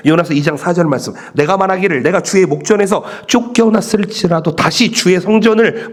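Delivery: a lightly lifted reading of the verse; scripture reading. Acted. Preacher reads Jonah's words to the church, solemn reading. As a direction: read the verse with weight to the congregation.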